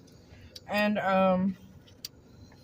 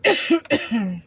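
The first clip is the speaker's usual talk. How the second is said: hard real cough, throat caught; coughing after swallowing wrong; choking cough; 2 semitones higher, 5 dB louder than usual. Real, not acted. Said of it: "Sorry, guys".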